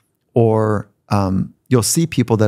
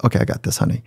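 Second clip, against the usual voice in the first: hushed reassurance; 4 dB quieter, much faster than usual. Retold or imitated. Dad reassuring mom, whispered.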